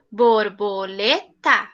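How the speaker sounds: "Borboleta" slow, four separate beats; syllable by syllable. Shown as a demonstration. Teacher sounding out a four-syllable word for children. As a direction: count out "Borboleta" beat by beat. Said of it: "quatro sílabas".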